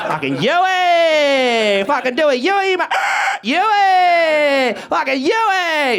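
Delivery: high, loud drawn-out bogan shout; mock Aussie yell. Comic playing Australians yelling his new name, high and loud. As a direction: bellow the name like a footy fan.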